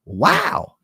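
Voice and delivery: ordinary delivery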